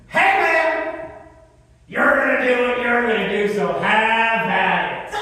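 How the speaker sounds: high-pitched, loud heckler shouting from the back; imitated heckler yell. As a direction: yell it like a guy across the club, stretching the ending. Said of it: "and yells".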